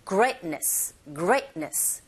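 "Greatness" hit on "great", t barely there; stressed first syllable, silent T. Shown as a demonstration. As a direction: punch "Great" and drop the t before "ness". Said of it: "거기에 힘을 꽝 주시고 트 사운드 거의 안 들리죠". ("Greatness"의 앞에 힘을 주고 t는 거의 없이)

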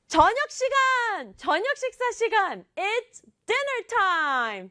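high, bright excited shout of dinner time; excited call. Retold or imitated. Kid announcing dinner, excited high call.

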